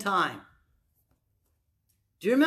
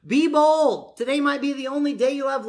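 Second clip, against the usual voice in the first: loud exhortation; 6 semitones higher, 3 dB louder than usual. Real, not acted.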